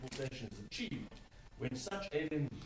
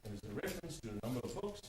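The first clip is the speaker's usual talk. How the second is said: ordinary delivery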